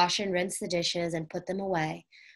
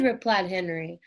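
ordinary delivery, nothing marked

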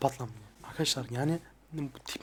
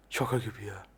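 soft spoken; 6 semitones lower, 4 dB quieter than usual. Real, not acted. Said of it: "bu ses tonuyla konuşuyorum da yani soft spoken".

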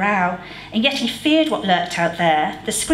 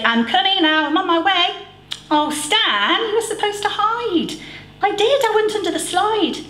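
put-on boy voices; 12 semitones higher than usual. A narrator's reading. Boys voiced during hide and seek.

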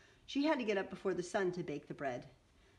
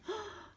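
sharp high gasp; surprised gasp; 6 dB quieter than usual. Acted. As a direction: suck in a quick delighted breath. Reader gasps at offer.

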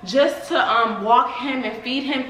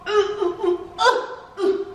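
laughing strained oohs; 7 semitones higher, slower than usual. Real, not acted. Lifting the heavy dog.

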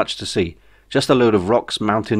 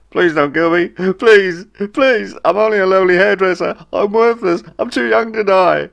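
high, loud, whining plea for mercy; pitiful begging; 10 semitones higher, 5 dB louder than usual. A narrator's reading. Hairdresser on his knees begging soldiers, high whining plea. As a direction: grovel shamelessly for your life. Ray begs for his life.

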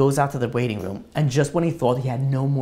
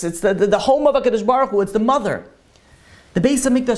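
high, urgent sermon delivery; impassioned preaching; 9 semitones higher, 6 dB louder than usual. Acted. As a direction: drive the parable home, climbing in pitch. Preaches the parable's meaning.

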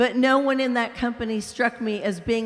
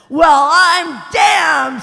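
loud, slow, higher disbelieving shout; big ghost, indignant; 6 semitones higher, 11 dB louder, much slower than usual. Acted. Big ghost shouting at the bright spirit, loud and outraged. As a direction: bellow it like an aggrieved bully.

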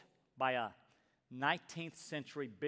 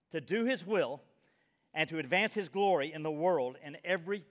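louder, fervent preaching read; forceful quote reading; 4 semitones higher, 6 dB louder than usual. Acted. Preacher reading Ryle's quote to the congregation with fervor. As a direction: proclaim the quote like a rallying call.

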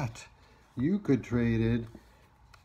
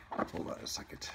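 whispering; 10 dB quieter, faster than usual. Real, not acted. Teacher aside while fetching coins.

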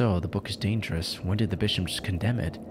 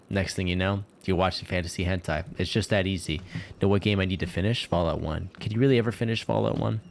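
ordinary talk, nothing marked

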